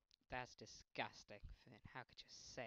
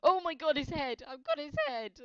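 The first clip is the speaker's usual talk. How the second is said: much higher, loud excited cry; excited shriek; 18 dB louder than usual. Real, not acted. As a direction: squeal with triumph. Excited catch in game.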